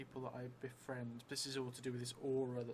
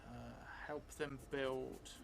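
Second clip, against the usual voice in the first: slow, drawn-out in-character telling, often hushed; slow story narration; 8 dB quieter, slower than usual. Acted. Vampire player dictating a new memory to the audience, slow hushed narration. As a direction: spin the vampire's memory word by word.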